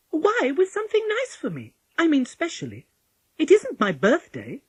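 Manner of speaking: put-on high, emphatic child's voice; Polly, a questioning little girl. A narrator's reading. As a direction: press the point, high and loud.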